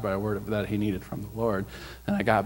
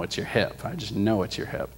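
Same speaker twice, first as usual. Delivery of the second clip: normal talking voice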